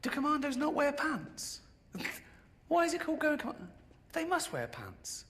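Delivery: high mock disbelief, laughing through it; incredulous questioning. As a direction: play it as a baffled little voice quizzing soldiers. Mock bewildered questioning of commandos.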